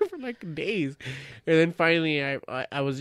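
ordinary talk

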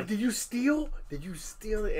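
high-pitched accusing parent, re-enacted; going hard. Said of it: "I'm, like, going hard".

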